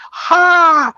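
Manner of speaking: one high burst of a laugh; sharp high laugh. Real, not acted. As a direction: bark out a single laugh. Loud laugh at topic switch.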